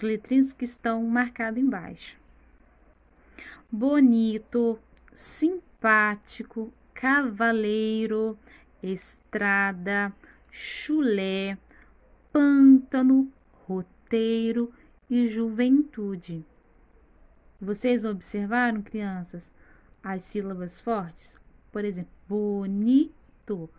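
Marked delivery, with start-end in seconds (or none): 3.7-16.4 slow word list, each stressed syllable leaned on: boNIto, simPÁtico, PÂNtano; measured classroom dictation, strong stress in each word from bonito to juventude; stressed syllables demonstrated. Shown as a demonstration. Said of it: "Pronunciem em voz alta e com naturalidade". 22.3-23.7 bonito slowly split as bo-NI-to, NI strongest; slow bonito with a heavy middle syllable; stress on NI. Shown as a demonstration. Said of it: "o ni é o mais forte".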